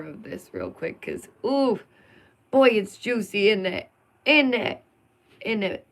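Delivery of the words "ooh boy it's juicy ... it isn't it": louder, relishing every word; gleeful gossip. Real, not acted. To her viewers, teasing up the drama gleefully. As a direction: savour the juicy gossip.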